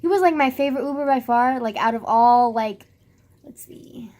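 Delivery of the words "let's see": barely audible whisper; hushed, voiceless aside; whispering to oneself. Real, not acted. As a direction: think aloud under your breath. Counting to herself, thinking.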